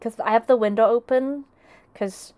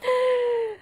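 squeaky high laugh; 13 semitones higher than usual. Real, not acted. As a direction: let out a high giggle. Laughs at loud sound.

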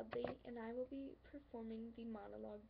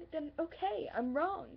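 loud, higher, defensive burst; exasperated concession; 5 semitones higher, 6 dB louder than usual. Acted. Conceding to the lover mid-argument, exasperated. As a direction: fling the admission out in frustration.

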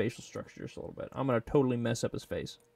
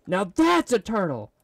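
triumphant; 8 semitones higher, 8 dB louder than usual. Real, not acted. Triumphant at finished turtle.

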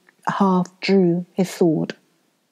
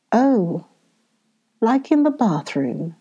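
ordinary delivery, nothing marked

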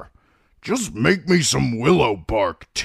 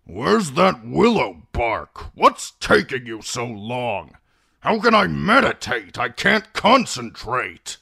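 put-on loud, higher cranky old man; impatient; 6 semitones higher, 4 dB louder than usual. A narrator's reading. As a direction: complain loudly, stretching the words. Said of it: "He gestured impatiently".

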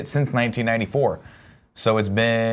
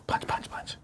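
rapid breathy whisper, mimicking strikes; whispered punches; 13 dB quieter, slower than usual. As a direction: whisper each mock punch after a sharp exhale. Acting out karate moves along.

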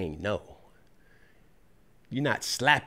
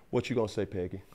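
ordinary delivery

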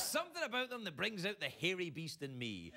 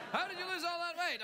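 mock question; 12 semitones higher, 4 dB louder, faster than usual. Mock interviewer asking Jared.

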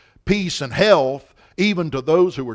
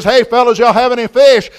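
loud, raised hail to men out in a boat; calling out; 6 semitones higher, 11 dB louder than usual. Acted. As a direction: call across the water. Man calls to boat from bank.

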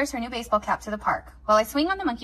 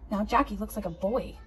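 plain reading voice

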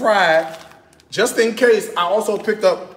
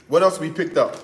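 normal talking voice

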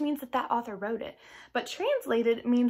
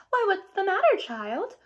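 higher, louder kindly godmother; a caring godmother calling to a crying child; concerned fairy godmother; 8 semitones higher, 4 dB louder than usual. Acted. Godmother finds crying Cinderella.

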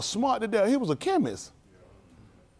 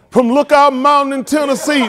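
high, loud mock preacher; mountaintop sermon; 6 semitones higher, 8 dB louder than usual. Comic parodying the mountaintop speech, loud hammed-up preaching. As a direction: boom it out like a famous speech. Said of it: "They all in here mountain top".

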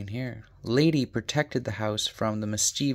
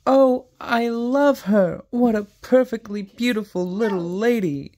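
cried happily; 12 semitones higher, 6 dB louder than usual. A narrator's reading. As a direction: gush over the puppy with joy. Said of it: "Darling cried happily".

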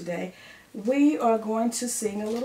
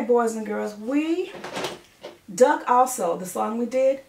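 ordinary talk

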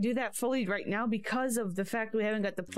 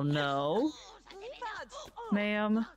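ordinary talk, nothing marked

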